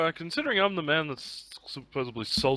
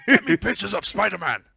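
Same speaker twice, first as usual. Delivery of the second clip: J. Jonah Jameson; 4 semitones higher, 5 dB louder than usual. Retold or imitated. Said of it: "J J Jonah from Spon".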